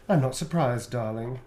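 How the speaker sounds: soft sassy purr. Shown as a demonstration. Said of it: "add the darling at the end".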